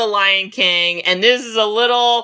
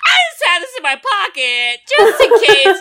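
excited laughing Shuri; 6 semitones higher than usual. Retold or imitated. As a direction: brag giddily about the surprise. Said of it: "And she's like".

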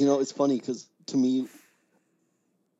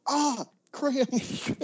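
mock pained yelp, pitched way up; re-enacted yell; 13 semitones higher, much slower than usual. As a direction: act out yelping at a sudden cramp. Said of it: "yell out".

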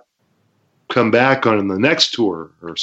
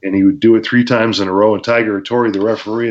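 ordinary talk

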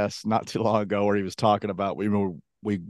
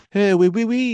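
mock French accent, higher and louder; 11 semitones higher, 5 dB louder than usual. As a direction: chirp it as a caricatured Frenchman. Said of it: "Everybody in there has a French accent".

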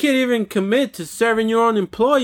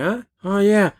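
usual speaking voice

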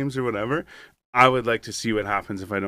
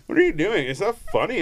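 put-on annoyed girlfriend; 7 semitones higher than usual. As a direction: imitate the startled girlfriend snapping at him. Girlfriend startled at him.

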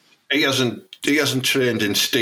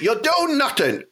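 much higher, jeering taunt; fighter taunting; 12 semitones higher than usual. Retold or imitated. Fan mimicking a cage fighter taunting his opponent, high jeering. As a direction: goad the opponent, sing-song and cocky.

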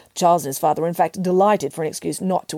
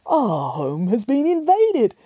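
slow, raised-pitch lament; father bear crying out; 5 semitones higher, slower than usual. A narrator's reading. Father bear finding the wrecked chairs, dramatic cry. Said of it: "cried the father bear".

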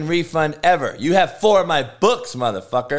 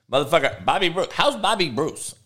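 normal talking voice

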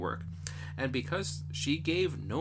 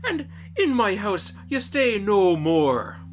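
loud, slow furious banishment; angry father; 6 semitones higher, 9 dB louder, slower than usual. Acted. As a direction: drive her out with a loud roar. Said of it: "he was that angry".

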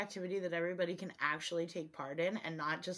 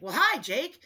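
higher and louder cheerful dad; a mock dad, pitched up and loud; 8 semitones higher, 11 dB louder than usual. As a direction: greet the caller like a chummy dad. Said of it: "my dad's like".

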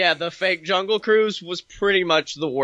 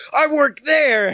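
excited shout; 8 semitones higher, 5 dB louder than usual. Retold or imitated. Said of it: "He was like".

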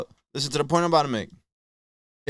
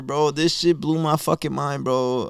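ordinary talk

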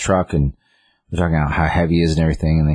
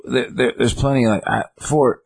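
put-on rambling old man; 7 semitones higher than usual. Gramps rambling, not making sense.

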